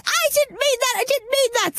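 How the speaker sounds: high, flustered puppet protest; Bosco puppet voice. Retold or imitated. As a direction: backpedal fast like a panicked puppet. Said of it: "He said".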